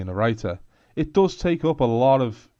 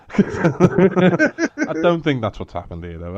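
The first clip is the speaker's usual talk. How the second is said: laughing; 6 semitones higher, 5 dB louder, slower than usual. Real, not acted. Laughing while joking about blurbs.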